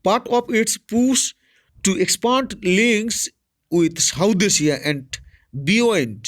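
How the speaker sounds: slow, careful reading of an English news item; reading aloud. Shown as a demonstration. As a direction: read it out word by word for students. Reads news text slowly aloud. (ধীরে ধীরে খবর পড়া)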